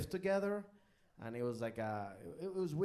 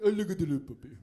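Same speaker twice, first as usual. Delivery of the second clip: put-on loud, excited baby-talk gush over a puppy; inner gushing; 4 semitones higher, 9 dB louder, much faster than usual. Man gushing loudly over a puppy inside his head. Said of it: "inside I was like".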